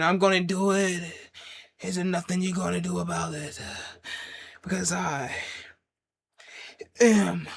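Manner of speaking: deliberate mock wrestler taunt; the Game's promo voice. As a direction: stretch each phrase like a wrestling promo. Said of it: "'cause I'm the game".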